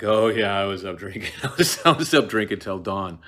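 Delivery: cheerful laughing admission; loud laughing porter confession. Retold or imitated. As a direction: own up with a laugh. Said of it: "the porter's like".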